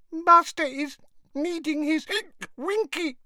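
high elf voice, tearful and hiccuping; mild whimper between hiccups. A narrator's reading. Drunk Winky pleads for her missing master, whimpering. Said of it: "whimpered the elf".

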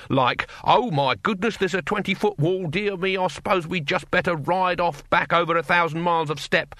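scornful play-acted alarm; sarcastic. A narrator's reading. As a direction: mimic a feeble, horrified invader. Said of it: "said Cohen sarcastically".

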